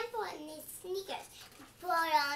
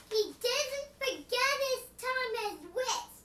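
plain reading voice

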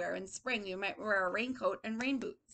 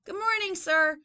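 put-on high, loud child's greeting; cheery little boy; 8 semitones higher, 8 dB louder than usual. Teacher voices boy greeting old man, high and cheery. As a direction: chirp it brightly.

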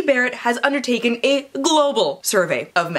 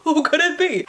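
ordinary delivery

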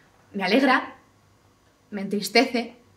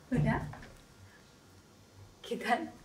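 soft little greeting to a puppy; coaxing a puppy; 10 dB quieter than usual. Acted. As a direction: coax it over softly, in small phrases. (llamando bajito y con cariño a un cachorro)

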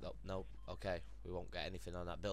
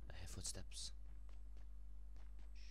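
hushed whisper while listening; whispering to find the enemy; 4 semitones lower, 9 dB quieter than usual. Real, not acted. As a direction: breathe it, stay hidden. Stalking enemy quietly.

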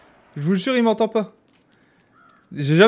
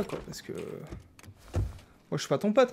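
ordinary delivery

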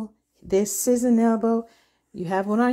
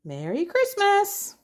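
christmas elf; 10 semitones higher than usual. Acted. Echoes child in elf game.

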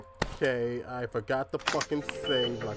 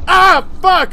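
high, loud panicked yell; panicked scream; 16 dB louder than usual. Real, not acted. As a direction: scream as he grabs you. Caught by monster.

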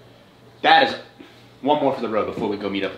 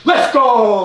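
hyped shout; loud triumphant call; excited cheer; 13 semitones higher, 9 dB louder than usual. Real, not acted. Celebrating before leaving.

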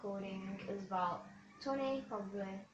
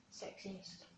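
whisper; 2 semitones lower, 14 dB quieter than usual. Real, not acted. Mock accusation aside.

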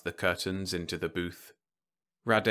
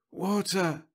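crying out; 11 semitones higher than usual. Acted. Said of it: "One cried".

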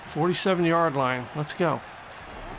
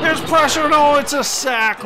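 higher, louder sudden hype call; excited play-by-play; 8 semitones higher, 7 dB louder than usual. Real, not acted. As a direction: yell the sack as it happens. Live sack in play-by-play.

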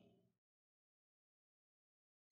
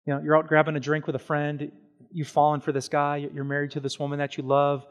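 normal talking voice

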